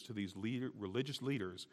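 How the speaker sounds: ordinary talk, nothing marked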